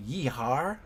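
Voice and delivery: light Midwestern cowboy "yeehaw"; cowboy accent. Shown as a demonstration. Streamer listing American dialects, mild cowboy accent. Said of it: "Midwestern cowboy kind of".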